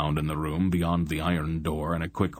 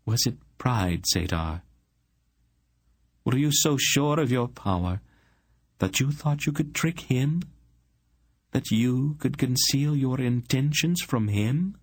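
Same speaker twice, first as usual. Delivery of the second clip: higher, probing woman's voice; Aunt Paul questioning; 7 semitones higher than usual. A narrator's reading. Aunt challenges the sorcerer over his pride, questioning. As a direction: press each question in a raised pitch.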